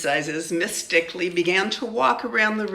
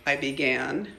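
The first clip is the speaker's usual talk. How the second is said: plain reading voice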